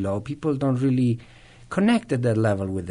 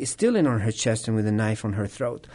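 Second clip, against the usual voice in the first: ordinary delivery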